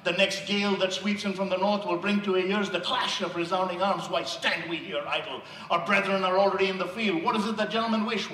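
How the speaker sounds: usual speaking voice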